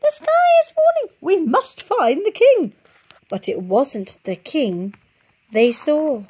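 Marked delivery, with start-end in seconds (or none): 0.0-2.7 shouting birds. A narrator's reading. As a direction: yell it in a panic.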